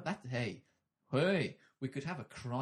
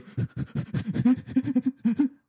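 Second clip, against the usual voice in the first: loud bawling sob; 13 semitones higher, 12 dB louder than usual. Pretend. Demo of bawling man.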